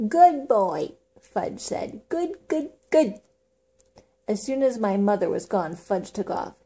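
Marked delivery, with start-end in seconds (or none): 0.1-3.2 put-on toddler voice. Toddler parrots mother.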